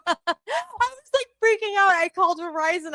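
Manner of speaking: high, fast panicky voice; panicked. Real, not acted. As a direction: squeak it out in a panic. Panicked streamer, voice shot up.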